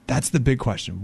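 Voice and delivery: ordinary delivery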